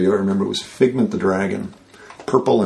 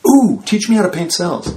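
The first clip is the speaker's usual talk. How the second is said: eager; 9 semitones higher than usual. Retold or imitated. Eager request retold.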